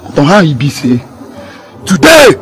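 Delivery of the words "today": high punched shout; sudden raised voice; threatening emphasis. Acted. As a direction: throw the word out high and hard. Threatening the other man.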